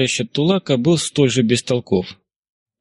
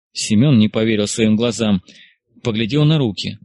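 ordinary delivery, nothing marked

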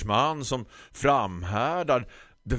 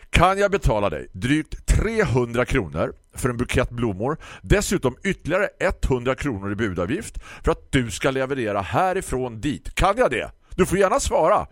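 louder, exasperated and pointed; lost patience; 6 semitones higher, 6 dB louder than usual. Acted. Customer, out of patience, presses the florist for an answer. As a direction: press each word, fed up. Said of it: "Nu hade jag tappat tålamodet".

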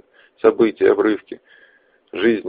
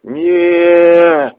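anguished scream; 10 semitones higher, 7 dB louder than usual. Acted. Max sees friend killed.

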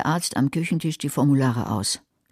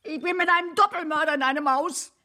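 overdone panic; 13 semitones higher than usual. Acted. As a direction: wail it like a drama queen. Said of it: "Übertreib nicht". (übertriebene, hohe Panik)

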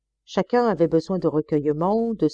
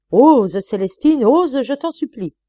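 louder, slow imploring; pleading; 7 semitones higher, 8 dB louder, slower than usual. Acted. Master begging the maid to insult him, imploring. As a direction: beg her to dare.

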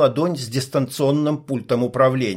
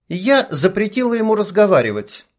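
curt; 7 semitones higher than usual. A narrator's reading. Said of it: "отрезала брюнетка".

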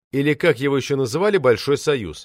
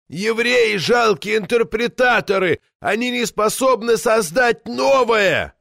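put-on drunken yelling; 9 semitones higher, slower than usual. A narrator's reading. Drunk ex-party secretary yells his tirade across the hall. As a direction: holler it out, drunk and raging. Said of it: "орал Золотуев".

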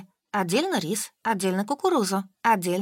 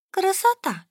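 high, pleased outburst; delighted exclamation; 11 semitones higher than usual. A narrator's reading. Solka admires the shabby eatery, delighted. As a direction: pitch it right up in delight. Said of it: "выдала Солька".

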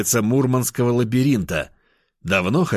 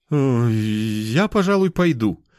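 drawn-out hesitation, then higher; stall, then excuse yourself in a raised voice; awkward excuse; 3 semitones higher, slower than usual. Acted. Hotel worker hurrying to leave, awkward and hesitant.

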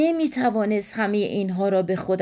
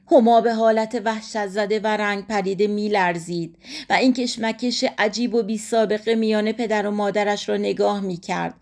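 usual speaking voice

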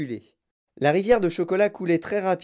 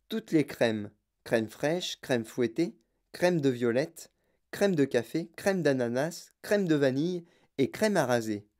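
plain reading voice

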